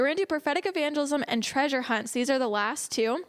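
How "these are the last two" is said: normal talking voice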